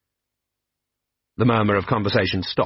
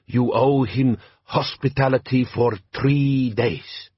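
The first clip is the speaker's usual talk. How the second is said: put-on slow, heavy-accented bandit chief; lightly voiced warlord, measured and proud; Jabbar, desert chieftain; 3 semitones higher, slower than usual. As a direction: lean into a slow Klatchian drawl. Jabbar's voice in the story.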